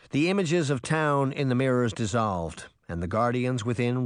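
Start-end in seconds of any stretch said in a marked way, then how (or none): none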